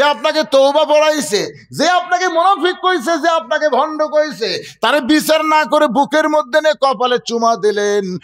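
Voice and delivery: high-pitched, insistent imitated protest; retold outcry of disbelief; indignant companions' protest. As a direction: pile up the accusations, voice pitched high.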